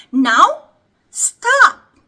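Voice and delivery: loud. Acted. Game command to freeze.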